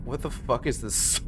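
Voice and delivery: ordinary delivery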